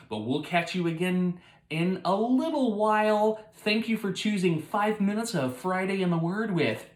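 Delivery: loud, lifted announcer-style outro; show host sign-off. Pretend. Father closing Five Minutes of Friday in the Word, announcer-like sign-off. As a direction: project a big, upbeat closing to the audience.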